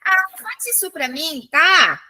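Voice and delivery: coaxing request. Shown as a demonstration. Teacher acting out someone asking a favour, coaxing request. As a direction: ask a favour, tagging "tá?" on the end. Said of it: "Então, ele tá pedindo".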